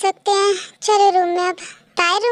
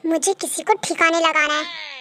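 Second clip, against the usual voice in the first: low, softened threat; menacing aside; 6 semitones lower, 5 dB quieter than usual. Acted. Girl vowing to fix someone, menacing aside. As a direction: let a threat slip out under the voice.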